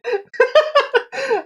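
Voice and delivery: high squeak copying the animal; high-pitched squeaky imitation; squeaky noise imitation. Shown as a demonstration. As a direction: try to copy the squeak. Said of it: "I can replicate that noise".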